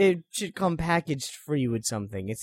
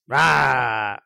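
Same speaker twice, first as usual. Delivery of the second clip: bitter wombat roar; 3 semitones lower than usual. Pretend. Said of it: "bitter wombat says".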